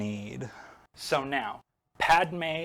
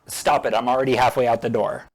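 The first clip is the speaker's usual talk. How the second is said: impatient brush-off; 8 dB louder, faster than usual. Retold or imitated. Yoda brushing Obi-Wan off.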